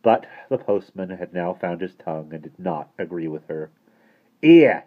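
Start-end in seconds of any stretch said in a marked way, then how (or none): 4.4-4.8 loud, high single-word protest; shout. A narrator's reading. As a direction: shout it sharply to get attention. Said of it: "he shouted".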